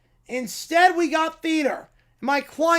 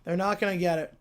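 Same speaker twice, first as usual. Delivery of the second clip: ordinary talk, nothing marked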